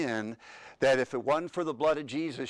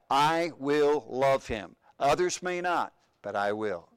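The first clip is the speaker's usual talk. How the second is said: drawn-out emphatic pledge; slow solemn vow; slower than usual. Acted. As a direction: vow it slowly, word by word. Repeating the vow slowly.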